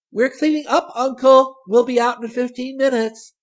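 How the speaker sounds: put-on high, loud girlish call; calling aloud. A narrator's reading. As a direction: call back through the door, very high. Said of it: "Aloud she said".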